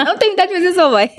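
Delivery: high, rushed teasing protest; joking protest. Real, not acted. Host teasing co-host about being her mother, joking protest. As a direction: blurt it out high and fast, in jest. Joke between laughs, then "tô brincando.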